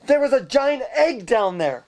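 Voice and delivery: high-pitched, mildly panicked retelling; mock freaking out. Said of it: "He was freaking out".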